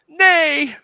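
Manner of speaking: high, loud anguished shout; theatrical wail of denial; hammed-up cry. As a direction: fling out the refusal. Luke's cry.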